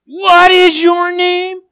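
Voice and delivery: loud, high, slow interrogation; very stern shout. A narrator's reading. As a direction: demand it sternly, stretching every word. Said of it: "really sternly now".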